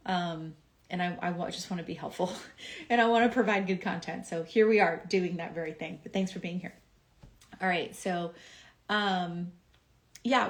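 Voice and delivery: usual speaking voice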